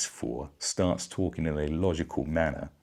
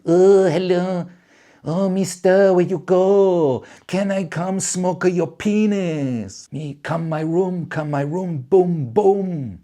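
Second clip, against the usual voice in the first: much higher, slow, loud falsetto come-on; mock ladyboy voice; 14 semitones higher, 8 dB louder, slower than usual. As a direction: coo it high and slow like a tout. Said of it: "It's gonna be more like".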